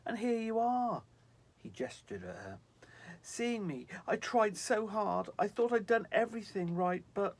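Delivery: high, loud despairing cry; despairing angel. Acted. Snow angel despairing at being seen by Sylvie.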